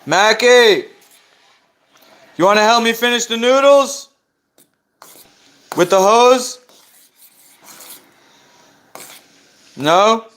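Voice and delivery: shouting high and loud across the room; calling out. Real, not acted. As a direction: call out to someone far away. Calling to Mackie across house.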